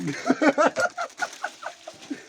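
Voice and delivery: real high burst of laughter. Real, not acted. Laughing at Hajj Fathi's joke, high burst of laughter.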